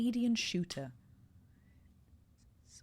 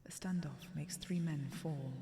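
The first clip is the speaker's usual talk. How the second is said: whispered recitation; 3 semitones lower, 7 dB quieter than usual. Acted. Player reciting the poem line, hushed whisper. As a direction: murmur it like a mysterious verse. Reading the found poem aloud.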